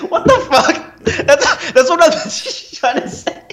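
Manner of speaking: incredulous, laughing out the words; can't stop laughing while talking; real laughter breaking through each word. Real, not acted. Cracking up at the joke.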